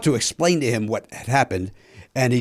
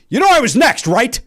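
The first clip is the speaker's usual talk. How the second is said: raised, higher voice of an affronted golfer; mock indignant challenge; 9 semitones higher, 4 dB louder than usual. Golfer confronts the man who took his bay, indignant. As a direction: challenge him loudly, pitched up.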